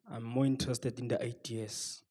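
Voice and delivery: normal talking voice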